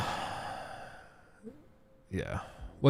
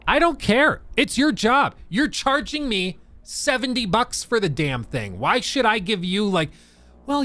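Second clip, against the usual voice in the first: raised pitch, pushing hard at the listener; heated rant; 7 semitones higher than usual. Real, not acted. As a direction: vent real frustration, voice high and pressing. Ranting at excuse-makers.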